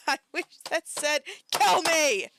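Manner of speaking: high, loud yell bursting on "kill me"; exasperated yell. Real, not acted. Wishing the post said otherwise, exasperated yell. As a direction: repeat it, erupting on "kill me". Repeats it as a shout.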